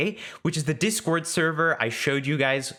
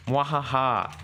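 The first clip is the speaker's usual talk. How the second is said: slow hammy villain laugh; mad scientist laugh; slower than usual. Pretend. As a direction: cackle like a cartoon villain. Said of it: "pretend to do something totally crazy".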